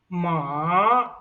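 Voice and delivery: "ma" falling then rising, drawn out; third tone. Shown as a demonstration. Teacher shows third tone meaning horse, "ma" dipping then rising. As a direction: dip down on "ma" and climb back up. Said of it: "The third tone is".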